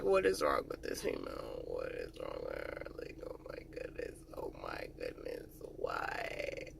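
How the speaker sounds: low, breathy exasperated whisper. Retold or imitated. Soldier muttering in disbelief about the scared female, whispered. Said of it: "I was just like".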